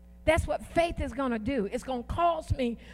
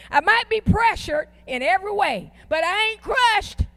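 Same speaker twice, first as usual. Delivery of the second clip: higher, emphatic declaration with attitude, drawing out CRUSHED; defiant declaration; 4 semitones higher, 4 dB louder than usual. Shown as a demonstration. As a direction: declare it to yourself with raised pitch and attitude. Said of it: "I'm declaring it".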